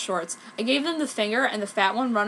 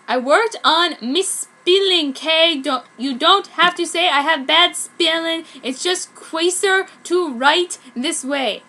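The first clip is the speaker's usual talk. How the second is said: higher, louder mocking author's note; 6 semitones higher, 7 dB louder, slower than usual. Reading the author's note in a hammed-up voice. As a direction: read it as a defensive author.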